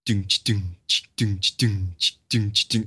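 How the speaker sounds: flat, even beatbox thumps with a hissed ch between; imitating a beat. Mimicking the boring beat for a friend, steady beatbox. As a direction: mouth the dull yoga beat, doom, ch, doom. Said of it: "It's just like".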